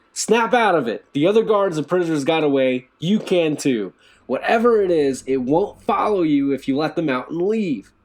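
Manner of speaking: loud, high urgent scream. A narrator's reading. Will screaming at the terrified guard. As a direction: scream to shake him awake. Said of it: "Will screamed at him".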